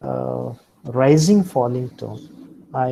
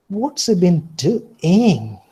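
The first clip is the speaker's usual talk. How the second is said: slow, higher and louder, "he" swooping up then down; suspicious mocking; 6 semitones higher, 6 dB louder, slower than usual. Shown as a demonstration. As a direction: doubt him, drag it out mockingly. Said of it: "suspicion or mockery".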